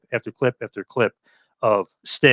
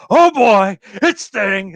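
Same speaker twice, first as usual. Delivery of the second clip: loud, high, thrilled announcer shout; Schiavone announcing; 9 semitones higher, 6 dB louder, much slower than usual. Retold or imitated. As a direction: erupt like a commentator going nuts. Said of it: "And Schiavone goes".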